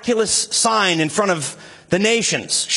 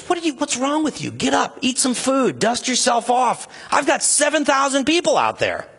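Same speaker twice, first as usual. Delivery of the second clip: higher and fast, half-scolding, half-rousing; exasperated pep talk; 7 semitones higher than usual. Retold or imitated. God telling depressed Elijah to get up, exasperated and rousing. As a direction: shake him out of his funk. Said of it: "And God says".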